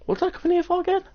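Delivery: high, bewildered squeak; confused high squeak; puzzled. Retold or imitated. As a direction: ask it lost, mind gone blank. Confused on entering a room.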